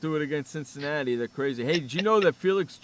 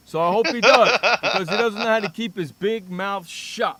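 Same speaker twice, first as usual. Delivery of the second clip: loud, higher jeer; loud gloating; 4 semitones higher, 9 dB louder than usual. Real, not acted. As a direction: jeer at the rival owner with full voice. Gloating rant at the rival owner.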